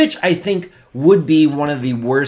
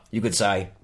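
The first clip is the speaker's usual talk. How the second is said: normal talking voice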